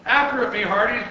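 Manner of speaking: loud, high pirate order; commanding. A narrator's reading. Captain to crew as ball escapes, loud command. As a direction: command the crew to give chase. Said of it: "Braid Beer commanded".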